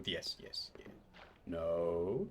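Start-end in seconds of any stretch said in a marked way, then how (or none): 0.0-0.9 whispered. Acted. Quiet encouragement.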